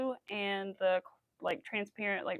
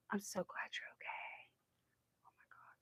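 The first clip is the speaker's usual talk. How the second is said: soft whispered relief; relieved whisper; 16 dB quieter than usual. Real, not acted. Telling the viewer she's glad they're okay, whispered. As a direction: breathe it out, relieved.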